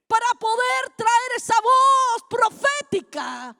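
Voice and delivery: fervent pulpit proclamation; impassioned preaching. Real, not acted. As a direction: preach it with full force, building as you go. Preaches at rising force.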